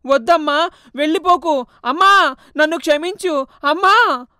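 desperate anguished shout; anguished cry. Acted. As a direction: scream after the vanishing mother. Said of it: "బాధగా అరుస్తాడు".

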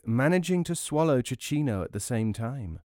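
plain reading voice

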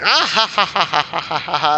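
a mock sinister ha-ha-ha, only lightly done; a lightly hammed creepy movie cackle; Vincent Price laugh. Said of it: "laughing like Vincent Price".

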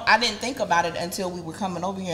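usual speaking voice

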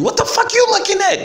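very high-pitched, quick comic yell; mock yell. Someone in the room challenging whoever walked out, high-pitched mock yell. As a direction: imitate someone yelling, voice leaping way up. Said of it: "somebody yell at you".

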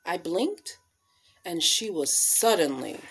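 expressive picture-book storytelling voice; reading to children. Acted. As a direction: read it like storytime on the rug.

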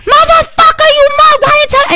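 very high, loud screamed trash talk; mock gamer scream. Boyfriend screaming at other players during Call of Duty, mock high-pitched scream. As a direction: imitate a gamer screaming into the headset. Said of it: "why must you scream at them".

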